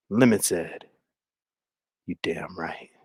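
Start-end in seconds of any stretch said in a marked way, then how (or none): 2.1-2.9 very low, very soft acted answer; low, intimate mock-drama; hushed reply. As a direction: murmur it like a mock love scene.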